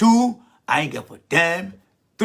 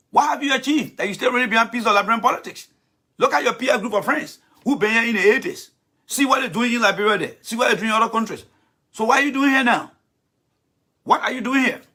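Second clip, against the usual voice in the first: scornful challenge; 6 semitones higher than usual. Real, not acted. Challenging Thomas, high pitched.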